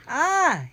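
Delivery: sharp high loud cry of pain; pained scream. A narrator's reading. Villain bitten by the dog, screaming in pain. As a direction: yelp out as if bitten. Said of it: "he screamed, panting".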